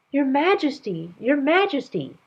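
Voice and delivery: high, loud calls from afar; chorus of servants calling Your Majesty. Acted. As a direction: call out urgently across the garden.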